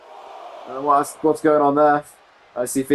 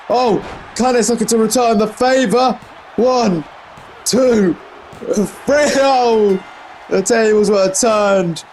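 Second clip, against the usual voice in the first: excited count; 7 semitones higher, slower than usual. Real, not acted. Commentator calling the three count, thrilled. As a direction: ride the pin all the way to the win. Live call of the winning pin.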